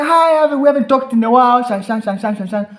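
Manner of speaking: lightly voiced chatty woman; chatty caller. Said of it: "She's like".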